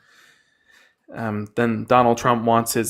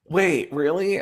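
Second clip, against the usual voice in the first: gasp, then real delighted disbelief; dawning joy; 3 semitones higher than usual. Real, not acted. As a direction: let the good news sink in with a gasp. Gift offer sinks in.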